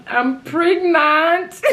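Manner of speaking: loud, high, drawn-out acted wail; crying confession. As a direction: wail the confession slowly, re-enacting tears. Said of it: "I'm still crying".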